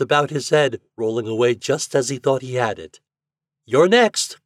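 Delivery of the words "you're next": loud, high call. A narrator's reading. Toad summons Fat to the apples, calling out. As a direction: call the next player up. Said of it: "called out Toad".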